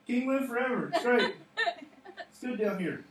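high, loud comic call; mock yell back. Preacher as Daniel answering the king from the lions' hole, mock yell. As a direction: holler up as if all is fine. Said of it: "yell back out of the hole".

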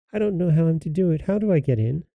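put-on high child's voice; little girl. A narrator's reading. Gretel tricking the witch at the oven, high innocent voice. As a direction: ask innocently in a high girlish voice. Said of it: "Gretel perceived her intention and spoke".

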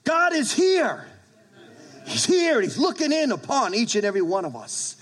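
high, forceful preacher's cry; emphatic proclamation. Real, not acted. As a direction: ring it out high, easing as you go on. Preacher proclaims God's presence to congregation.

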